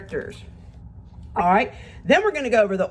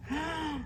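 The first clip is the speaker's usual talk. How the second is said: quiet high gasp; storyteller's hushed gasp; soft gasp; 7 semitones higher, 13 dB quieter than usual. Acted. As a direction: gasp in mock surprise. Reading picture book to kids.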